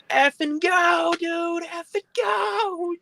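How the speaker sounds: very high, drawn-out mock scream; retold panicked screaming. Friend screaming at the driver to leave, hammed-up panicked scream. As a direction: imitate a friend frantic to get away. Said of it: "he's screaming his head off".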